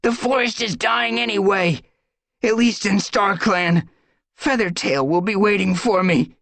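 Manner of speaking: high, loud, bitter defiance; defiant. Acted. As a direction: hurl it back at them. Crowpaw's defiant retort continues.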